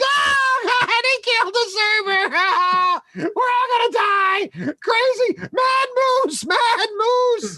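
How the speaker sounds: hammed-up high-pitched panic; woman screaming. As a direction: shriek in mock terror, stretching the words. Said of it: "the woman screams".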